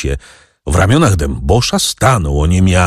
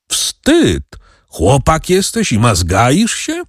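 high, playful mock scolding; feigned indignation, pitched up; mock anger; 7 semitones higher, slower than usual. A narrator's reading. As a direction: scold the boy without meaning it. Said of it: "zgromił go z udanym gniewem".

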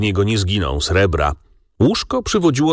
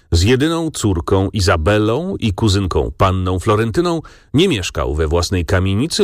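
ordinary delivery

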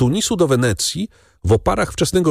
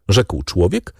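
ordinary delivery

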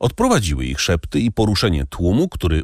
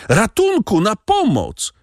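cry for help; 12 semitones higher than usual. A narrator's reading. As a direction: scream for help in terror. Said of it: "krzyknęła panna Darmilly". (rozpaczliwy krzyk o pomoc)

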